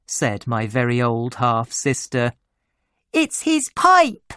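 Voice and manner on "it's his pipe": put-on very high, excited child cry; little sister's shout. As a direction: squeal out the answer as a child. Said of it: "shouted my little sister".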